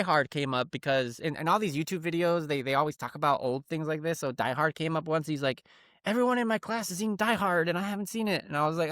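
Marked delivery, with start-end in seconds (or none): 6.1-8.5 higher-pitched retold kid's complaint; pleading son. Son tells dad everyone has seen Die Hard, higher pleading voice. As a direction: imitate a child making a case, pitched up.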